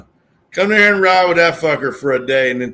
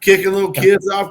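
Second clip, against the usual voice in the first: usual speaking voice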